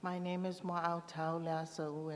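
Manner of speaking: ordinary delivery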